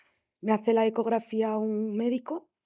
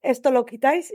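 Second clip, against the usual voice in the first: frantic demand; 4 semitones higher, 7 dB louder than usual. Retold or imitated. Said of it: "me puse como una loca".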